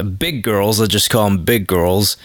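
normal talking voice

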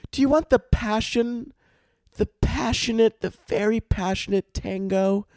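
high-pitched mock server voice; mock barista. Barista offering an embarrassing tea to a customer, high mocking voice. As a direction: offer it high, lingering on each grand word.

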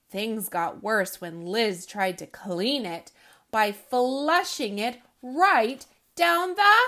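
put-on animated children's book reading, loud punchlines; lively read-aloud. A narrator's reading. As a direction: read it with big comic energy for kids. Reads picture book aloud.

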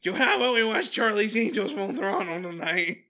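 loud, high, hammed-up awkward chat; Jason's awkward voice. Imagined Jason making date talk, awkward and loud. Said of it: "does the actor have an awkward voice".

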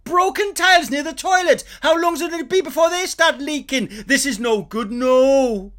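high, loud Welsh snagging inspector; Welsh builder impression. Impression of the Welsh snagging guy tutting at a new build. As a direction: pick apart a shoddy build in Welsh. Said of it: "You're very good at an impression of him".

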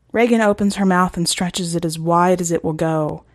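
ordinary delivery, nothing marked

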